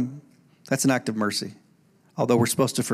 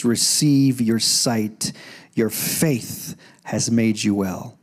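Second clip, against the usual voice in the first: slow, low, deliberate words of healing; compassionate pronouncement; 5 semitones lower, slower than usual. Acted. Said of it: "Jesus, in a great act of compassion".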